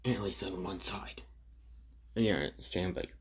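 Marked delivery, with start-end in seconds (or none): 0.0-1.2 hushed unvoiced afterthought; dark whispered aside. Suku whispering a dark family hint to Stain. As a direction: drop to a whisper for the twist.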